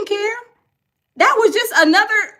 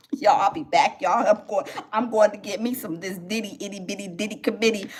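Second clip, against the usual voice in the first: put-on much lower, sly sing-song as the eager girl; 10 semitones lower than usual. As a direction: tease it out, sneaking off with glee. [name] leaving for [name].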